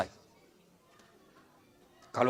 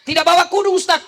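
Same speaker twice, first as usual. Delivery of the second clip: higher, louder complaining congregant; 11 semitones higher, 9 dB louder than usual. Acted. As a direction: fuss like a worried worshipper. Jamaah bertanya kepada ustaz. (jamaah mengeluh, suara tinggi)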